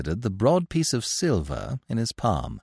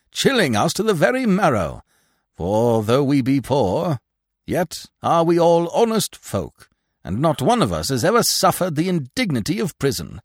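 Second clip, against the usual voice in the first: grand declaiming; 6 semitones higher, 4 dB louder than usual. A narrator's reading. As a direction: hail him like a stage hero. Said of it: "the big man declaimed".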